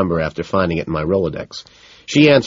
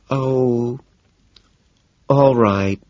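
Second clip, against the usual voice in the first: resigned; 6 semitones higher, much slower than usual. A narrator's reading. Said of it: "she says, resigned".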